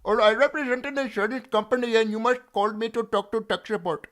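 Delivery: high, loud Indian tech-support caller; loud, high put-on Indian call-center voice. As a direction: play a pushy insurance-company support agent. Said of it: "Why do you talk to me in tech support voice?".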